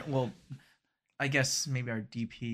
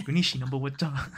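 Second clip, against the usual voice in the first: put-on heavy Hmong accent on number; thick Hmong accent. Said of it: "such a thick Hmong accent".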